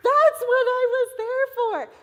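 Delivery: high, loud burst of joy; excited. Real, not acted. Telling seminar teachers about her answered prayer, excited and loud. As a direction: exclaim it like a revelation.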